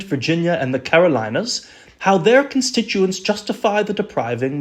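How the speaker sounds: hammy, self-consciously bad British accent; terrible period British accent. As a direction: read it as an old royal governor with a badly faked British accent. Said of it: "Please excuse my terrible accent".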